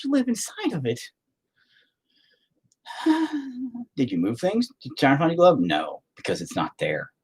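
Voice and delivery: ordinary delivery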